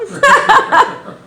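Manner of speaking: loud, high burst of laughter; real laugh at own joke. Real, not acted. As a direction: break up laughing. Teller cracks up at punchline.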